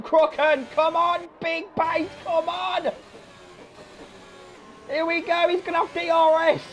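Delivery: high-pitched cheering commentary; excited play-by-play. Real, not acted. Commentator willing Crocken on for the win, excited shouts.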